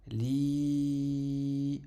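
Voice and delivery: LI with a long held EE; prolonged vowel. Shown as a demonstration. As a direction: sustain LI on a level note. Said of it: "avec la prolongation le ya".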